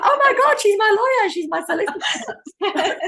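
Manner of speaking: mock-starstruck squeal, high and loud; excited clients. Clients spotting their lawyer on telly, mimicked in loud excitement. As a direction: imitate thrilled clients, loud and high-pitched, half laughing.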